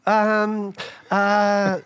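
ordinary delivery

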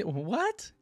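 ordinary delivery

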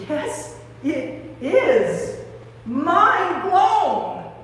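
growing amazement. Acted. Mary sure it is Jesus, amazed and building. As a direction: build emphatically into open amazement.

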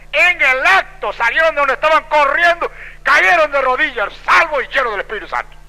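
loud, high triumphant proclamation; full-force preaching. Real, not acted. Preacher telling of the boys' instant conversion, full-force proclamation. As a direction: drive the climax home at full voice.

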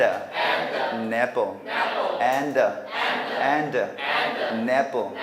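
"and an" as an-dan, "an apple" as a-napple; slow syllable drill linking "an apple"; linked pronunciation. Shown as a demonstration. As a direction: carry each n and d onto the next vowel in "and an". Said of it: "얘가 옮겨오고".

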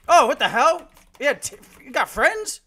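loud, high-pitched shock; startled outburst. Real, not acted. Gamer ambushed by a player who has friends, startled outburst. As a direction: yelp it out in disbelief. Caught by an enemy squad.

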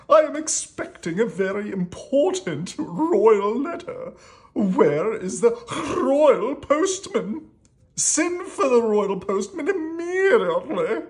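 high, loud, imperious storybook king; hammed-up royal impatience; demanding king. A narrator's reading. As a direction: order the servants about, drawing out the big words. Impatient king summons the postman.

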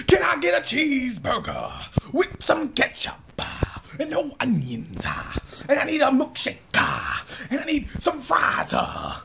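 hammed-up sermon cadence with "uh" after each phrase; mock evangelist. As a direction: order food in a loud, high preacher shout. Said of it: "don't walk into McDonald's talking about".

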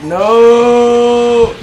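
long, high, loud wail; dismayed cry. Real, not acted. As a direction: hold the word in loud dismay. Dismay at losing in game.

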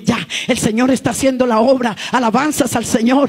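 loud, high fiery preaching. Acted. Preaching at full force. (predicación encendida)

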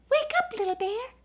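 high gentle snowman call; Sparkly waking him. Acted. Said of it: "and the voice said".

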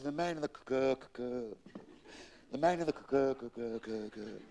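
stuck repeating the hard C; hammed-up stutter; acted stammer. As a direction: stammer helplessly on the start of a word. Stuttering sailor relays the message.

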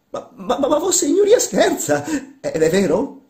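high, loud stammering disbelief; flabbergasted. Acted. Workman and master querying a joke, flabbergasted.

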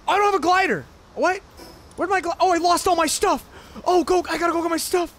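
high loud dismay; panicked shock. Real, not acted. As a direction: freak out over lost gear. Lost all items.

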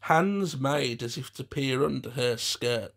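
ordinary delivery

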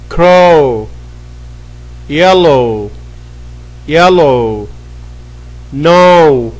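crisp classroom drill; pronunciation drill. Shown as a demonstration. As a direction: say each word like a teacher for the class to repeat. Teacher drills English words.

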